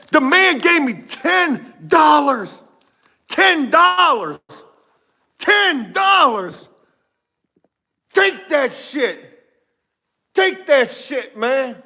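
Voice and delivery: high, drawn-out outraged disbelief; mad, incredulous rant. Retold or imitated. Painter fumes over the ten-dollar offer, incredulous and mad. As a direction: stretch each word high in exasperated outrage. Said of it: "I was so mad".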